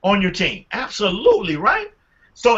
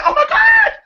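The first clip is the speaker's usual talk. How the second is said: high-pitched, loud mock wail; mock horror; 7 dB louder than usual. Coach playing a waitress refused coffee, mock horror. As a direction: shriek it in hammed-up dismay.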